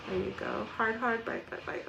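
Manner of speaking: ordinary talk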